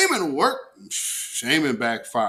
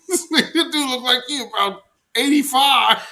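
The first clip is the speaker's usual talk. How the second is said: laughing; 12 semitones higher, 4 dB louder than usual. Real, not acted. Laughing while mocking old man.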